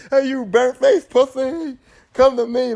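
high, slow taunting call; hammed-up challenge; mock Kincaid taunt. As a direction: dare him to come get you. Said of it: "I was like, "Yo," he was tripping".

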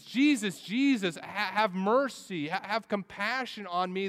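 loud high pleading cry; crying out for mercy. Retold or imitated. Sinner calling to Jesus for mercy, loud high plea. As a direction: beg Jesus at the top of your voice.

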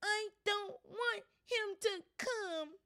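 high, slow, loud fake wail; fake crying yell. A narrator's reading. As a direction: whine like a spoiled brat. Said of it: "Dudley yelled between huge pretend snobs".

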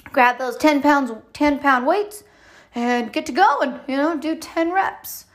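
playful imitation of a personal trainer urging on; coach voice. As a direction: pump them up like a gym coach. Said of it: "want someone to tell me".

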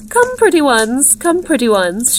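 high sing-song coo, falling on each call. A narrator's reading. Strange woman calling her rabbits out to feed, cooing. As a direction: coax little pets in a high voice. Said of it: "she cooed".

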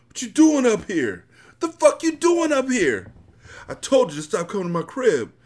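loud, high-pitched furious yell; angry yelling. A narrator's reading. Bullet confronting the crackhead in the hallway, angry yelling. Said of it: "I heard Bullet yelling in the hallway".

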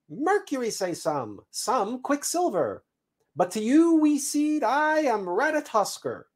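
very high, chirpy squirrel voice; proud squirrel messenger. Acted. Squirrel introduces himself to the buried seed, proud chirp. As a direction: introduce yourself grandly in a high squirrel pitch.

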